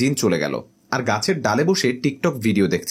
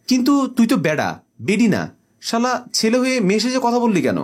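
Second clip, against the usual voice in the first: raised, indignant scolding, pitched high; angry scolding; 7 semitones higher than usual. Acted. Jinn scolding the boy who posed as a girl, high indignant outburst.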